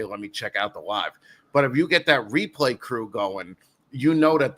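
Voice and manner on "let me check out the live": low, soft throwaway line; quiet casual viewer. Retold or imitated. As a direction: mumble it like a lazy viewer.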